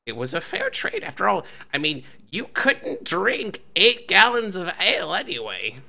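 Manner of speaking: high, stammering halfling voice, rising in bursts; flustered little swindler talking his way out; panicky halfling excusing himself. Acted. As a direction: plead your case in a high, squeaky pitch. Halfling pleads from the ground.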